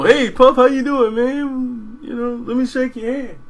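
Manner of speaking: high, loud, overly friendly hello; pushy fan greeting, played up and pitched high; mock eager greeting. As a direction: ham up a starstruck fan rushing over. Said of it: "I wasn't trying to get over there to go".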